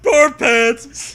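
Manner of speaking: loud high goofy yell; loud comic echo; silly shout. Real, not acted.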